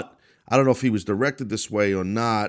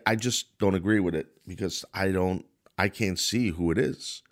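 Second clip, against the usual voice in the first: ordinary talk, nothing marked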